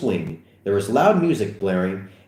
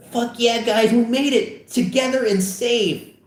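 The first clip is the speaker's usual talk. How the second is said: put-on higher, cheering teen boy; triumphant teen voice; 14 semitones higher than usual. Friend celebrating at the door, higher and triumphant. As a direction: celebrate arriving safe at last.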